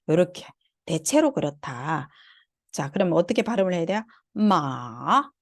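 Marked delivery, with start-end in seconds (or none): 4.3-5.3 마 dipping low, then rising; third tone. Shown as a demonstration. Teacher showing students the third tone, dipping 마. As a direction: dip down on 마 and swing back up. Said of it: "이렇게 올려주면 돼요".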